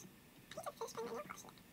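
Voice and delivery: very soft, near-whispered aside; quiet aside. Real, not acted. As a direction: say it almost to yourself, barely voiced. Muttered aside about her own things.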